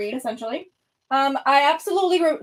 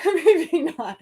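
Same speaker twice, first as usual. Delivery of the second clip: laughing; 4 semitones higher, 3 dB louder than usual. Real, not acted. Laughing at her own claim.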